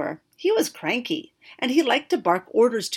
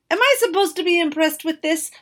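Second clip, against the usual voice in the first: higher, louder scornful wizard; fuming; 9 semitones higher, 9 dB louder, faster than usual. Acted. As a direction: scoff at the flower. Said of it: "the wizard fumed".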